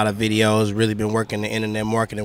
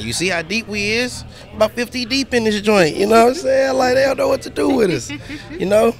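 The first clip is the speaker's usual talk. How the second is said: much higher, hyping the crew; hyped up; 12 semitones higher, 4 dB louder than usual. Real, not acted. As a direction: brag about the crowd.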